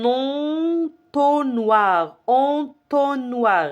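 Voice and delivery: ENTONNOIR stretched out, en-ton-noir; teacher modelling en-ton-noir for repetition; syllable by syllable. Shown as a demonstration. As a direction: draw out each syllable of entonnoir very slowly. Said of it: "répétez".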